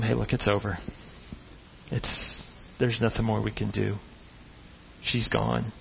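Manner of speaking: low, soft, gentle telling of a death; hushed bad news. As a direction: break the news softly. Messengers quietly delivering death news.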